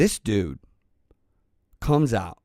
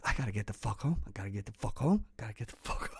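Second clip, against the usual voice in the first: much softer, fading to a whisper; exhausted muttering to self; 3 semitones lower, 12 dB quieter, much faster than usual. Retold or imitated. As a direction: chant it under your breath to stay awake. Muttering to stay awake riding.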